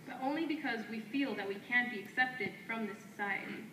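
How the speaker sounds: firm, declaiming poetry lines traded back and forth; spoken word duet. Acted. Two poets trading lines on stage, in a firm declaiming delivery. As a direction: answer your partner line by line like a chant.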